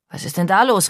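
mildly called-out question; rufend. A narrator's reading. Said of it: "rief Vater aus der Kammer". (leicht erhobene Stimme, fragend gerufen)

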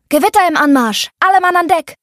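shouting alarm. Acted. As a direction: call everyone on deck, loud. Bursting in, waking the crew.

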